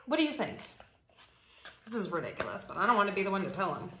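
usual speaking voice

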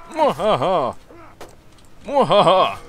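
evil cackle, loud and high; mad scientist laugh. Pretend. Gloating over winning battle.

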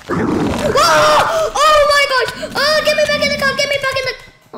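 high, loud frantic cries; panicked shouting. Real, not acted. Gamer panicking after being thrown from the car. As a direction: shout desperately to get back in.

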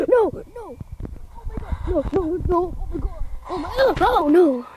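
high shrieking panic; terrified screaming. Real, not acted. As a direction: scream in terror. Jump scare by ghost.

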